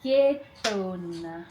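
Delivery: "Ke, tona" spoken very slowly, each syllable drawn out; slowly read. Shown as a demonstration. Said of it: "Now I'm going to read it slowly".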